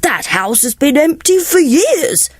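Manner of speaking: put-on slow, dismissive old officer dog; blustering sheepdog. Sheepdog scoffing at the cat, slow and dismissive. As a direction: wave it away. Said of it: "said the Colonel".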